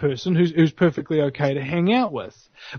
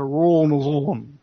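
"rural New Zealand" in southern Kiwi accent, R's rolled together; South Island accent, "rural" run into one lazy syllable. Shown as a demonstration. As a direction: slur "rural New Zealand" lazily, South Island style, jaw barely moving. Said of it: "they would pronounce a".

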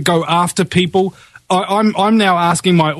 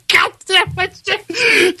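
high-pitched laughter, slow words; cracking up mid-sentence; laughing; 14 semitones higher, much slower than usual. Real, not acted. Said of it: "I just find it intensely funny".

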